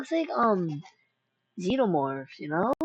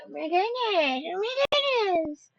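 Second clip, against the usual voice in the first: ordinary delivery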